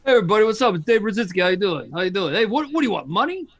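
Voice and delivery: a light, loud throaty Brooklyn accent impression. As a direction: greet the crowd like a Brooklyn guy, throaty and loud. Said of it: "a throaty Brooklyn accent".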